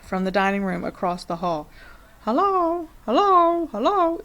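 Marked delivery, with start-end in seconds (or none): 2.2-4.2 much higher, louder bird voice; parrot calling. A narrator's reading. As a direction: call out like a hidden parrot. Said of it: "a voice came loud and clear".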